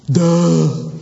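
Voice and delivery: drawn-out dopey "duh"; mock dim-witted Thomas. Preacher mocking a clueless apostle, dopey and slow-witted. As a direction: play the slow-witted disciple for laughs. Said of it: "And Thomas said to him".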